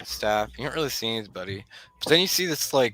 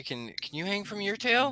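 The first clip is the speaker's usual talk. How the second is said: lightly voiced higher-pitched curious kid; Tony, curious; 10 semitones higher, 4 dB quieter than usual. Game master as Tony asking Lucy about her tail, curious. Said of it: "He looks at Lucy".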